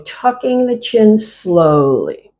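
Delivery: slowed right down, each word stretched; slow motion. Shown as a demonstration. Teacher slowing the class's moves down, slow and drawn out. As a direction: guide the class at a crawl. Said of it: "One more at the slow".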